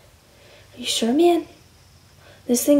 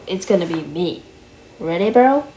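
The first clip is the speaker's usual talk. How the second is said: usual speaking voice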